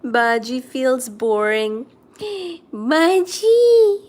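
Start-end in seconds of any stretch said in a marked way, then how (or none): 2.2-2.7 gasp. Real, not acted. Reacting to cat. 2.7-4.0 high, loud call of the cat's name. Real, not acted. Calling the cat.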